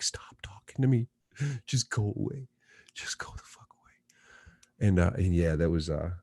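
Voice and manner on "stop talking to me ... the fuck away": unvoiced whisper, fading softer; pleading whisper. Stoned son in the shower whispering at his dad, pleading whisper. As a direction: hiss it to make dad leave. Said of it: "I'm like".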